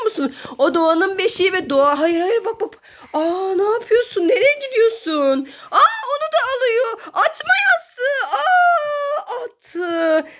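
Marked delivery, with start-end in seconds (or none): 5.7-10.3 high, fast panicked cries; panicked shriek. Real, not acted. Mother shrieking at the toddler throwing pillows. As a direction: shriek in alarm as the pillow flies.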